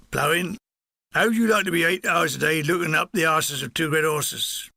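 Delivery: put-on grumbling farmhand with a Cornish accent. Former farmhand asked about ploughing, grumbling in Cornish accent. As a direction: answer sourly as a Cornish ploughman.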